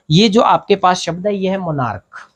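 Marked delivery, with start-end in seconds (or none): 1.6-2.3 "मोनार्क" ending in a hard k. Shown as a demonstration. Teacher showing students how to say "मोनार्क" with k. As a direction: say "मोनार्क" with a k where ch is written. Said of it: "ये सीएच कै बन जाएगा".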